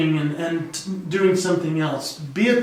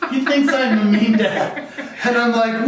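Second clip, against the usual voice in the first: laughing; 5 semitones higher, 3 dB louder than usual. Real, not acted. Laughing at son's remark.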